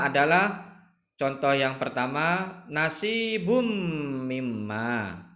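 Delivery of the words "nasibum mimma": nasibum mimma with the nun merged into a nasal m and a held hum; tajwid recitation. Shown as a demonstration. Teacher reciting nasibum mimma to the class, slow and chanted. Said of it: "Ini contoh idgham bi gunnah".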